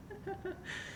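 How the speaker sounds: a short high giggle; soft high giggle that falls away; high breathy giggle. Real, not acted. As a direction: giggle softly at yourself. Laughing at own words.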